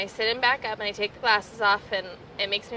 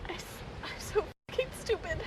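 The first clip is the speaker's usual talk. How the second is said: tense, high, half-whispered self-insult; strained hushed self-blame; self-reproach; 11 semitones higher, 6 dB quieter than usual. Shown as a demonstration. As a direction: hiss the words at yourself in disgust. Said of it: "say it just the way you say it".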